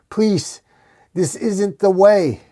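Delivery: high, slowing desperate appeal; pleading. A narrator's reading. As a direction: beg him from your knees. Priest on his knees begging Batman.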